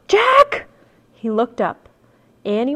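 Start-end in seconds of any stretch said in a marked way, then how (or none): 0.0-0.7 high gasp, then loud cry of the name; startled gasp. A narrator's reading. Girl spotting a huge dinosaur, gasp and startled cry. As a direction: catch your breath, then call out in alarm. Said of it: "Suddenly she gasped".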